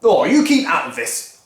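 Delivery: irritated husband. Acted. Mister Cranky brushing off his wife, mildly irritated. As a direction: wave the wife away. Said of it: "cried Mister Cranky".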